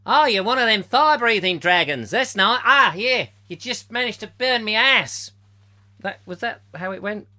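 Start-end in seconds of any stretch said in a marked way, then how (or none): 0.1-5.3 high, loud hammed-up Australian bloke; mock Australian accent. Mocking Pete's Dragon as Australian, hammed-up Australian accent. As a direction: belt it out in an over-the-top Australian accent. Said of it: "the original you told me was Australian".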